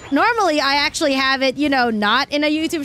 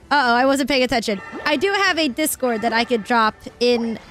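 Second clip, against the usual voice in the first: usual speaking voice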